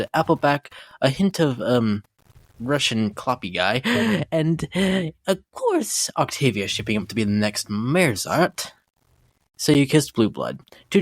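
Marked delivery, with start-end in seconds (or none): 5.3-6.1 swooning of course. Acted. Gushing over Octavia.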